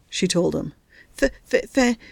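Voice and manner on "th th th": stammering with cold, then getting it out; chattering teeth on each start; shivering stutter. Acted. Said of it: "He was still shivering a little".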